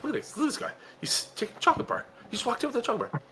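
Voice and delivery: high, soft mock-scandalised murmur; high, hushed running commentary; hushed onlooker. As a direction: imitate someone secretly pointing out a thief. Acts out staff watching camera footage.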